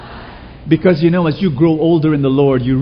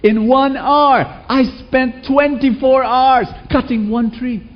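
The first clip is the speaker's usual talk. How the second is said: higher, slow, indignant complaint; angry complaint; 9 semitones higher, slower than usual. Acted. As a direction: complain like a cheated customer, higher and drawn out. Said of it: "very angry".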